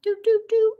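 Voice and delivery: high, sing-song little doops; playful doop sounds. Real, not acted. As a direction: bounce out some silly high doops. Playful noise while flipping cards.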